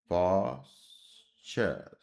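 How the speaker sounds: पॉस्चर stretched slowly, ending on चर; slow spelled word. Shown as a demonstration. Teacher showing students the चर sound, saying पॉस्चर slowly. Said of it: "अब इसी का पॉस्चर".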